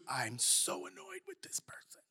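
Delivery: whispered grumble under the breath; grumbling about a person in a whisper; muttered complaint. Retold or imitated. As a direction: whisper your annoyance so they can't hear. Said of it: "all you can do is".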